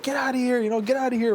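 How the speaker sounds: high-pitched mock wife yelling; imitating wife's shout. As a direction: mimic her shooing someone off, pitched well up. Wife confronting intruder, retold.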